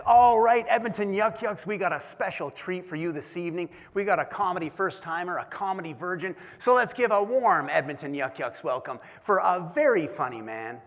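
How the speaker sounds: shouted emcee announcement; MC hype intro. Retold or imitated. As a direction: pump up the crowd like a comedy club host. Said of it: "he grabs the microphone and he says".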